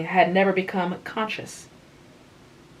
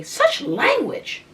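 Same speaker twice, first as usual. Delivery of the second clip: much higher and slow, mock-shocked; scandalised scold; much slower than usual. A narrator's reading. Anne scolding Tom's dialect, slow and shocked. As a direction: drag it out, shocked at his words. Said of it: "Anne scolded sharply".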